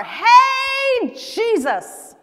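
high, loud, drawn-out holler; shouting. Acted. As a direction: call out to Jesus over a crowd. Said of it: "Bartimaeus shouted even more".

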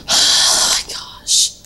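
slow, voiceless gasp of words; exhaled whisper. Real, not acted. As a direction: let it out on one long breath. Relief or dread mid-fight.

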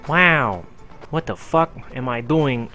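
ordinary talk, nothing marked

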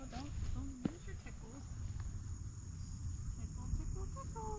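soft tickle game. Acted. Tickling child softly.

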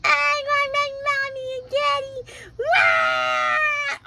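put-on high wailing child's sob; tearful shouted tantrum; crying kid. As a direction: wail like a lost little kid.

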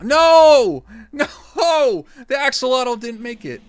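loud, high wail with a laugh in it; dismayed shouting. Real, not acted. Streamer watching the axolotl fail, dismayed shout. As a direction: cry out in playful horror.

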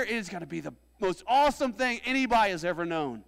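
building to a high, loud sermon climax; impassioned preacher in full cry; full-force preaching. Acted. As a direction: preach it up into a ringing shout, voicing God's promise.

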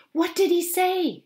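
high, loud, breathless question; eager cry. Acted. Mother pressing Alice about the lawyer's call, eager cry. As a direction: pounce on the news. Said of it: "she cried".

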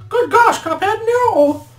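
loud, very high cartoon squeal; Mugman crying out. Brother warns against the dice throw, high-pitched cry. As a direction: cry out in a squeaky falsetto, drawn out at the end. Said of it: "cried Mugman".

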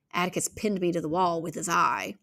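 ordinary delivery

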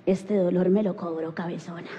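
low vengeful mutter; mock-sinister mother under her breath; vengeful mom aside. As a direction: drop low for a grudge-holding aside.

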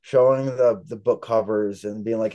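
ordinary talk

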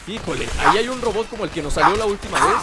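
ordinary talk, nothing marked

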